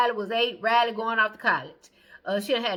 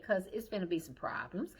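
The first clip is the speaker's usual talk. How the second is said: whisper; 4 semitones lower, 10 dB quieter than usual. Pretend. Veiled threat aside.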